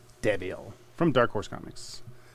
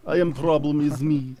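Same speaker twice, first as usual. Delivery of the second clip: bad Russian accent; 3 semitones higher, 4 dB louder than usual. Repeating the joke line in a bad Russian accent. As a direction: lean into a clumsy Russian accent. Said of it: "If you had a bad Russian accent".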